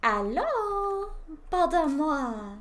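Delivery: mock French accent, high and loud; hammed-up French greeting. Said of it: "I must speak in French accent".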